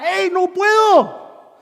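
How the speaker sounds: high, slow, stretched-out cry for help; drawn-out high mock yell; retold shouting. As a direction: holler helplessly to the shore, acting it out. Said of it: "Y les gritaba".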